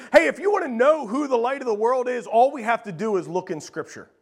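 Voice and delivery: ordinary talk, nothing marked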